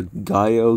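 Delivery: "Gallo" said like "guy-oh"; long I, silent L. Shown as a demonstration. Vlogger trying the editor's surname another way, "Gallo" as "guy-oh". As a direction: say "Gallo" Spanish-style, double L as Y. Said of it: "however you say his name".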